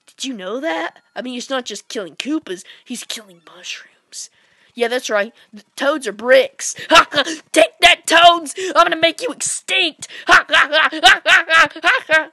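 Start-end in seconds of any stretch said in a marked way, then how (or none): 2.8-4.3 hushed secret whisper; conspiratorial whisper. Pretend. Secret murder reveal. 6.9-12.3 loud high cackling villain; hammed-up maniacal laughter; evil villain gloat.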